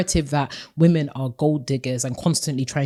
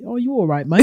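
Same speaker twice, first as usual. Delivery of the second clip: higher-pitched mock British lad accent; 5 semitones higher than usual. Shown as a demonstration. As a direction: greet them like a playful British lad. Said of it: "that British accent that's like".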